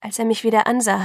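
ordinary delivery

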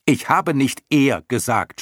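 high, loud, slow correction; half-annoyed shout. A narrator's reading. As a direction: shout it up, stretching each word. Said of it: "schrie Bastian halb ärgerlich, halb lachend hinauf". (halb ärgerlich hinaufschreiend)

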